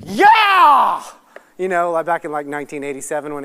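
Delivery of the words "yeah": huge, high, drawn-out yell; excited cheer. Retold or imitated. Fan watching the wizard in the movie theater, excited cheer. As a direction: cheer at full voice like a thrilled fan. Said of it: "I was so excited".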